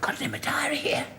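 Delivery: whispered aside during the scene; hushed aside in rehearsal. Acted. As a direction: whisper so the actor won't hear.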